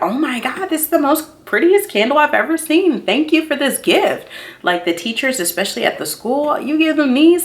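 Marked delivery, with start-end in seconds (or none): 0.0-4.3 high, gushing oh my God; gushing gift recipient. Retold or imitated. Vlogger imitating a friend gushing over a candle gift. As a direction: gush like a thrilled friend opening a gift. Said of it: "people are gonna be like".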